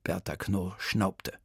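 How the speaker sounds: plain reading voice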